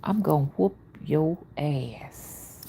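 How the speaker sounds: slow whispered threat; mother's quiet threat. Retold or imitated. Mother quietly threatening her daughter Vanita with a whooping, whispered. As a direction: hiss it like a calm, scary mother. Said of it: "you know what, Vanita?".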